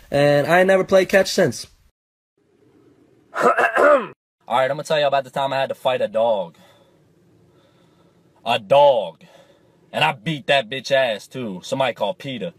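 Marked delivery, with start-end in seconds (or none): none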